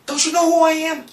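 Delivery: rising high, mock-outraged demand; indignant outburst. Demanding the manager recognize him, high mock outrage. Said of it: "I said".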